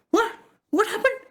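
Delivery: high-pitched bewildered question; acted jolt out of a daze; startled. Narada snaps out of the illusion before Narayana, startled.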